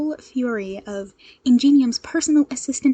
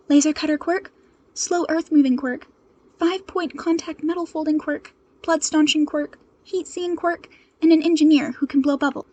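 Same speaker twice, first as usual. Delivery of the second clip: raised, pressing voice over the radio; urgent hero call; 5 semitones higher, 5 dB louder than usual. Acted. Ochako radioing the agency for backup, urgent.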